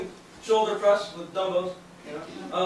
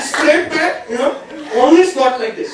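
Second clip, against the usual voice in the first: gym coach; 3 semitones higher, 8 dB louder than usual. Acted.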